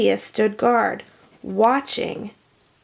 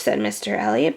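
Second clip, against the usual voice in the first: ordinary delivery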